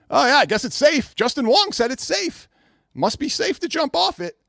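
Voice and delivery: high, dopey mock follower voice; a lightly voiced fool who buys it; mocking gullible fans. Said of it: "John D and Mike B will be right behind him going".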